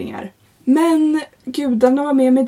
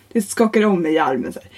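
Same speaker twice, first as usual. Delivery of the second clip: ordinary delivery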